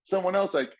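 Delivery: ordinary delivery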